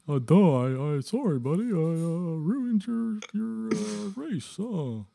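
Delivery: Mater voice. Retold or imitated. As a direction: play the dim-witted tow truck, apologizing. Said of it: "Like Mater".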